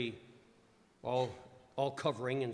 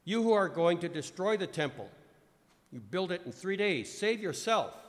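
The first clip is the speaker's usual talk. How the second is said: louder, higher jeering call; raised voice of the crowd's taunts; mocking taunt; 6 semitones higher, 9 dB louder than usual. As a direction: hurl it like a mocking passer-by. Mockers' taunts quoted.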